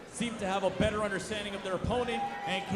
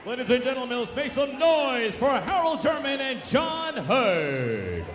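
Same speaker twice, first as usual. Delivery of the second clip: ring announcer; 3 semitones higher than usual. Real, not acted. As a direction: draw out the names for applause. Ring announcer crowd call.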